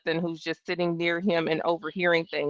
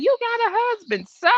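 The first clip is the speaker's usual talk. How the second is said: a much higher mock-innocent voice; mocking everyone; 14 semitones higher than usual. Mimicking people dismissing her fear, mock high. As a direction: imitate the people around her. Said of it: "And everybody was going".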